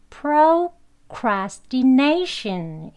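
procrastination very slowly, full "pro" and "ti", NAY stressed; dictionary pronunciation. Shown as a demonstration. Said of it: "그렇게 발음하게 되면요". (procrastination을 발음 기호대로 천천히 또렷하게)

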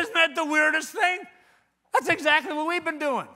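loud, high-pitched exclamation; hammed-up astonished outburst; excited amazement. Retelling an amazed phone exchange.

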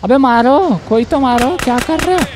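high, whining yelp; exasperated urging. Real, not acted. Player begging teammates to shoot in the chase, exasperated. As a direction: pitch leaps up in protest without extra volume.

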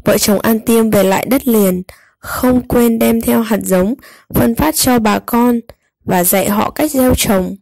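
usual speaking voice

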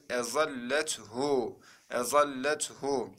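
"Ezallethu" slow, with held doubled L; model reading. Shown as a demonstration. Teacher reads the next word for students, slow model reading. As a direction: stretch "Ezallethu" syllable by syllable. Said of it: "Sıradakine bakalım arkadaşlar".

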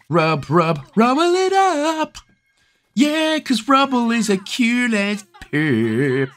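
very high, slow cartoon puppy voice; high pup cheering for digging rubble; squeaky pup voice.

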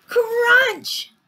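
very high, loud crunch; shouted sound word. Acted. Reader voices Sammy eating his friends, shouting. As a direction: shout it like a huge sudden chomp.

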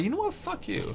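mock squeaky high voice, breathy laugh; high squeaky retort. Telling off friends in a squeaky high mock voice. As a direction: squeal it in a silly high pitch. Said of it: "And I'm like".

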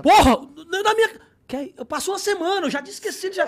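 a shocked shout that calms down by the end. Retold or imitated. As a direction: blurt it out in disbelief. Shocked it really was Neymar.

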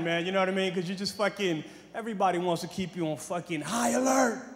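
normal talking voice

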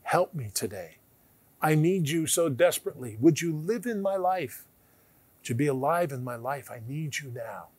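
soft, pleading prayer voice; hushed prayer. Shown as a demonstration. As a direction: lead the sinner's prayer gently. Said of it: "Just pray out loud and say".

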